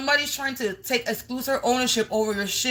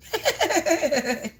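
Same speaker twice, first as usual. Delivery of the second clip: laugh; 7 semitones higher than usual. Real, not acted. Laughs at random comment.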